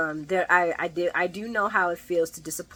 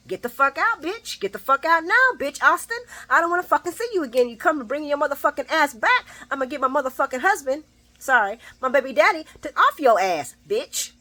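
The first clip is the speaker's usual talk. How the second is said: Ava's fantasy rant; 6 semitones higher than usual. Retold or imitated. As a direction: let the inner Ava unload on him, fast and high. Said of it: "the Ava in me was saying".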